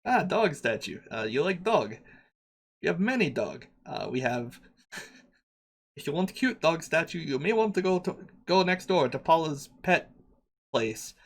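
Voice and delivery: lightly put-on broken-English shopkeeper accent; a hint of a foreign merchant haggling; vague foreign accent. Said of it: "what the fuck is this accent".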